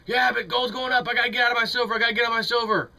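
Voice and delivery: high, anxious, hyped-up trader voice; whiny flip-flopping investor; mock panicky day trader. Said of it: "you sound like a stupid day trader".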